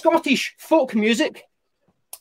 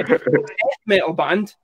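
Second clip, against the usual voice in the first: ordinary talk, nothing marked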